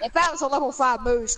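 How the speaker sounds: normal talking voice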